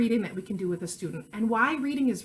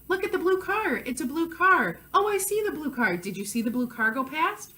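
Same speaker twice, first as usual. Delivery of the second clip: higher, lively parent pointing things out; parent monologuing to child; 5 semitones higher than usual. Shown as a demonstration. Parent showing a child a blue car, animated monologuing. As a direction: chatter brightly to a young child, pitched up. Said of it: "is monologuing. Talking, talking".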